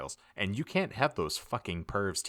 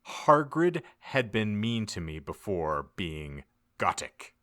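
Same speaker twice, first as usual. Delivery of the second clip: ordinary delivery, nothing marked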